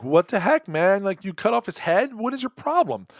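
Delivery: half-acted disbelief, pitch soaring at the end; shocked outrage. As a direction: cop aghast at a severed head, mock outrage. Retold shocked cop confronting him.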